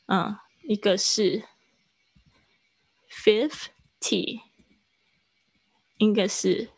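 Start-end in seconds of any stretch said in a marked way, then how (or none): 3.1-4.4 "fifty" broken into two separate syllables; sounded out. Shown as a demonstration. Teacher writing it out for class, syllable-by-syllable "fifty". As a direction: say "fif", pause, then "ty". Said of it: "我把它就是拼出来".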